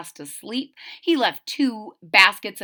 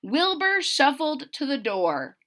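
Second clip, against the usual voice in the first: ordinary delivery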